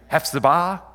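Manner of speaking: Hephzibah with an open final syllable, hef-suh-BAH; offered pronunciation. Shown as a demonstration. Preacher trying out readings with the congregation, Hephzibah stressed at the end. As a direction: try Hephzibah with weight on the final BAH, questioning. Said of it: "That's where your accent is".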